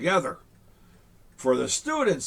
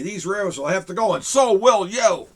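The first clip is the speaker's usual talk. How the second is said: angry yell; 4 semitones higher, 7 dB louder than usual. Acted. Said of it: "he yelled".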